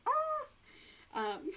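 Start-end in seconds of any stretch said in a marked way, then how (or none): 0.1-0.5 dismayed surprise. Retold or imitated. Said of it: "So I'm like".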